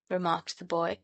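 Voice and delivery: ordinary delivery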